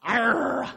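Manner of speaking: put-on loud, drawn-out kid growl; acted angry "arr" roar; frustrated growl. Shown as a demonstration. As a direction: growl out pure exasperation. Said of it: "it's pronounced".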